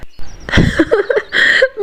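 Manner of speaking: breathy, mocking chuckle; scornful laugh. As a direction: laugh it off before the retort. Scornful laugh before retort.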